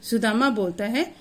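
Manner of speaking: normal talking voice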